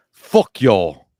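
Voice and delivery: loud joking curse; playful loud fuck-off to the chat; mock outburst. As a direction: blurt it out for a laugh. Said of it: "What I said was".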